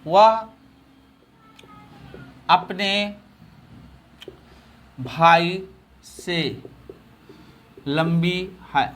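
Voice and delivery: long pauses between words; slow dictation. Shown as a demonstration. Teacher dictating to students, word by word. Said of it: "अब इसी फार्मूले पर रख दीजिए".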